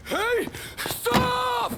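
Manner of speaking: loud, high shout to stop someone; shouting after someone in alarm; calling out. Acted. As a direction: yell to halt them.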